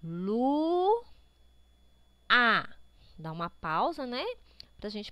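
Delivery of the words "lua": lua as a long rising "luuu", pause, then "a"; very slow, syllabified. Shown as a demonstration. Teacher leads pupils in saying lua very slowly, syllable by syllable. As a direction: stretch lua out, breaking it cleanly before the a. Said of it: "fale a palavra lua bem devagar".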